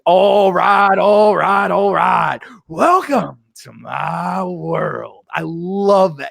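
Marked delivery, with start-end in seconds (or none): 0.0-5.3 drawn-out movie-star catchphrase impression; hammed-up slow, grand welcome; Matthew McConaughey. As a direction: imitate a showman, stretching the welcome very slowly. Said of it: "Matthew McConaughey walks in the room with the classic".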